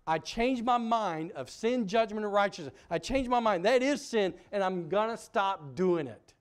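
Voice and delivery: loud, high, fervent confession; fervent prayer. Shown as a demonstration. Preacher leading the church in a loud, high repentance prayer. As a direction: cry it out like a penitent.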